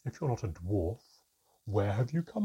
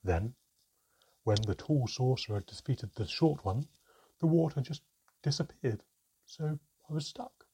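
loud, aggrieved account; bitter storytelling; 4 semitones higher, 7 dB louder than usual. A narrator's reading. Mermaid tells how sorcerers left her stranded, bitter. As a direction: recount the grievance with force.

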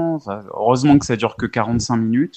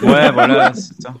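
higher and louder, bursting with laughter; laughing; 10 semitones higher, 6 dB louder than usual. Real, not acted. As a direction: agree while cracking up. Agreeing with a funny remark. (éclat de rire en approuvant)

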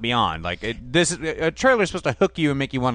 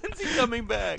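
mock crying; 10 semitones higher, 6 dB quieter than usual. Mimicking crying Casey.

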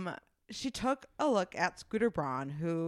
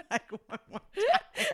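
mock exasperated; 11 semitones higher than usual. Joking about a dollar article.

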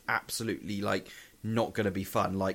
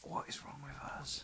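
whispered aside; whispering; 14 dB quieter than usual. Real, not acted. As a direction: say it under the breath with no voice. Unvoiced aside.

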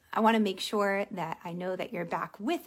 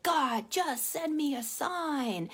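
higher, drawn-out mock plea; pleading prayer; 4 semitones higher, slower than usual. Driver begs God for a sign, pleading. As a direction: slowly beg heaven, imitating desperation. Jim Carrey prays while driving.

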